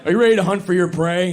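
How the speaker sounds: loud mock alpha-male rallying; alpha male influencer. Cigar-smoking influencer rallying men, mock alpha-male voice. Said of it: "guys with the aviators smoking the cigars".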